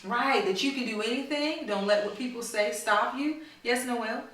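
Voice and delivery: ordinary delivery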